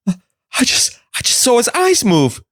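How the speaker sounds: high distressed sister. Acted. Sister insisting to the doctors she saw his eyes move, high and pleading. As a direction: play the sister, high and shaken.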